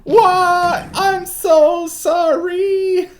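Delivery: very high, loud hammed-up wail; wailing apology. Game character apologizes after being scolded, wailing theatrically. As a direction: bawl it out slowly in mock anguish.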